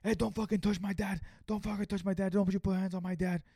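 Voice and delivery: high, loud angry outburst; pissed off. Retold or imitated. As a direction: imitate a furious son warning someone off his dad. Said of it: "He's so fucking pissed".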